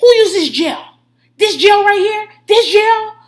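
loud, high outraged demand; imitating a man yelling over gel. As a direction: holler an accusation at the whole house. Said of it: "He's yelling at everyone".

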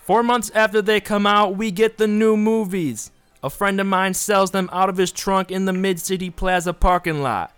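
loud high New York ad guy; New York accent. Pretend. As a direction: pitch it like a brash New York huckster. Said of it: "do it with, like, a New York accent".

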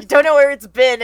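loud, quick mock warning; mock scolding. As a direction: blurt it out like a fussing guardian. Echoes the warning as a quote.

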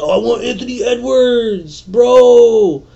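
high, put-on voice of greedy fantasy players; mocking eager fantasy drafters. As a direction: whine like a hyped fan wanting young stars. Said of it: "Everybody's gonna be like".

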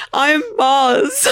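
high, drawn-up imitation of an emotional intro; mock emotional. Teasing the co-host over their intro, mock emotional. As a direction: imitate a co-host's intro like a confession. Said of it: "emotional when you were like".

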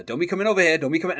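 put-on Memphis accent. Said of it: "his accent kinda comes through quite strongly".

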